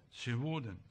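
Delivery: low, hushed aside; soft echo. Shown as a demonstration. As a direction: drop the voice to a murmur. Soft echo after each sin.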